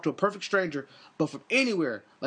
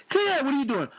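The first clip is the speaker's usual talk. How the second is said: put-on high girl voice; 6 semitones higher, faster than usual. Girl voice continues.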